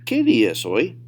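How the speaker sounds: advanced-level demo without stretching; natural speed. Shown as a demonstration. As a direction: run the question together at native pace. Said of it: "say them at natural speed".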